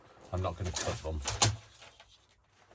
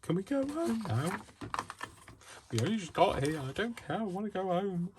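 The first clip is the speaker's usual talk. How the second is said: much higher whining plea to go home; whiny child voice. Retold or imitated. Imagining herself at a nightclub, high childish whine. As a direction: whine like a tired kid at a club. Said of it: "I'll be like".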